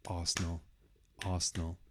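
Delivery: ARsenal, first syllable strongest; stress on first syllable. Shown as a demonstration. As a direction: hit the AR of Arsenal. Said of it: "the stress, the biggest sound is on the first one".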